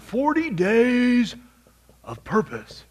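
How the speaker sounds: slow, sing-song mock promotion; mock hype. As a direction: stretch it out like overblown hype. Mocking a hyped church programme.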